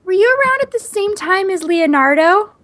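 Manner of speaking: put-on high, slow child voice; kid quoted. Imitating a boy questioning Michelangelo, high kid voice. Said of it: "And he goes".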